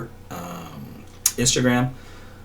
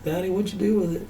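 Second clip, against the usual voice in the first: higher, puzzled little-dog voice; voicing the dog; 9 semitones higher, 4 dB quieter than usual. Small Yorkie asking its owner where the toy went, in a higher puzzled voice. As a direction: speak for the puppy, a bit lost. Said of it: "He's like".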